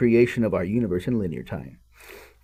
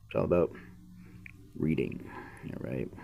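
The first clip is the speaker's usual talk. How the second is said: breathy whispered all right; whispered aside; 6 semitones lower, 11 dB quieter than usual. Real, not acted. Reader whispering a quick check to the viewer. Aside after jokey reading intro.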